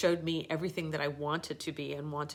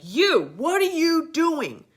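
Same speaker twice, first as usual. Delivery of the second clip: slow, much higher, louder demanding shout; imitating an angry trainer calling her out; hammed-up angry yell; angry challenge; 12 semitones higher, 13 dB louder, slower than usual. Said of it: "got really angry".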